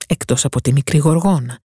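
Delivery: ordinary delivery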